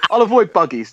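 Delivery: laughing through the line. Real, not acted. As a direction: giggle while saying it.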